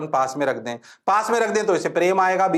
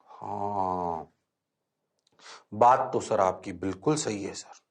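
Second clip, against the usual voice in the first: low, soft pretend-student reply; mock student agreement; 8 semitones lower, 7 dB quieter, slower than usual. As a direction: play the class meekly agreeing, low and quiet. Voices students' meek agreement.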